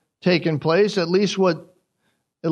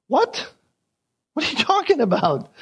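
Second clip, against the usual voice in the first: high-pitched mock disbelief; imitating stunned relatives; a hammed-up startled what; shocked; 7 semitones higher than usual. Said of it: "Everybody's shocked about this".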